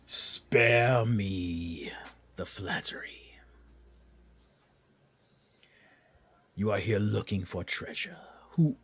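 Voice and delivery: slow, hissing threat from a dragon; a dragon toying with intruders before melting them; menacing dragon. Acted. GM voices the dragon.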